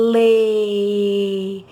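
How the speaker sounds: "lê" drawn out loud and open-mouthed; exaggerated syllable. Shown as a demonstration. Teacher shows the child her mouth, exaggerated syllable. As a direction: mouth "lê" big so the child sees it. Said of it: "Olha aqui a boca da tia".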